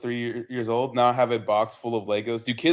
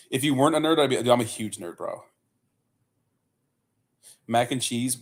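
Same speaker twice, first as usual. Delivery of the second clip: usual speaking voice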